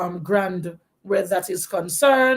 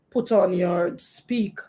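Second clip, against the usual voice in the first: usual speaking voice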